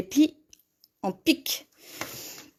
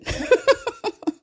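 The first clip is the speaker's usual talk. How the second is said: high giggle at her own slip; bursting laugh; 10 semitones higher than usual. Real, not acted. Woman laughing at her own colour mistake, high giggle. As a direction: laugh at the mix-up.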